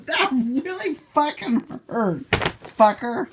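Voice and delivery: high, loud swearing right after the scratch; pained outburst. Real, not acted. Owner swears at the cat after a scratch, in pain. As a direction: curse the cat through real pain.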